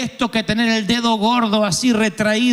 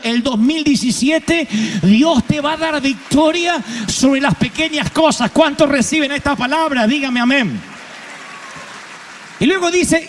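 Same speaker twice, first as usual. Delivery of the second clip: preaching at full force; 6 semitones higher than usual. Acted. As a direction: lift the congregation toward an amen. Preacher calls for amen.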